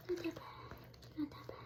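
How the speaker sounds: soft soothing whisper. Real, not acted. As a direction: whisper it to calm him. Comforting bird after bath.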